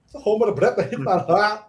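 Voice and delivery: high, loud mock-Scottish; Scottish gibberish. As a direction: imitate a thick, garbled Scottish accent. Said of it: "It just sounded like Scottish gibberish".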